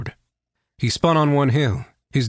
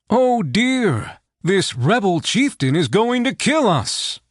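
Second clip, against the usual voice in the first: much higher, hammed-up fake panic; sarcastic mercenary faking fright; mock terror; 7 semitones higher, slower than usual. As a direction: pretend to be terrified, mocking the captive. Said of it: "Horn clapped his hands to his cheeks".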